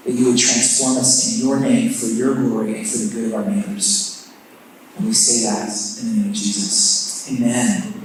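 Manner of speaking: hushed congregational prayer. Acted. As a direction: pray it quietly and reverently, dropping low. Opening prayer before introducing speaker.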